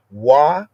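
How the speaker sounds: teacher sounding out wa. Shown as a demonstration. Teacher giving the Hebrew word as wa. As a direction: say wa with a rounded w, not a v. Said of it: "some say va yikach".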